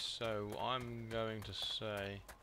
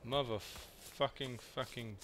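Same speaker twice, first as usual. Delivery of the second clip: normal talking voice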